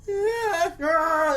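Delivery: loud high wailed "yeah"; mock kid crying. Imitating the kid who starts crying, high loud mock wail. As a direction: bawl it out like a mocked crying kid. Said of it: "this kid starts crying".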